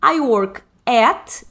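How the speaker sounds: slow, spaced-out I work at; slow demo phrase. Shown as a demonstration. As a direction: lay out each word slowly for students. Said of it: "Eu posso falar assim". (bem devagar, palavra por palavra)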